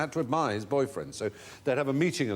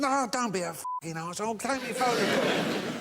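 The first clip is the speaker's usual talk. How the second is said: put-on high, insistent drunk; high pushy drunk, partly laughing; drunk fan; 11 semitones higher than usual. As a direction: nag like a drunk who won't take no. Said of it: "He said".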